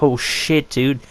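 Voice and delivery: usual speaking voice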